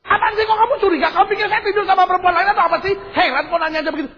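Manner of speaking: fast, high-pitched defensive outburst; mock husband's testy tirade; irritated husband. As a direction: snap back in indignant high voice. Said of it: "Suami langsung".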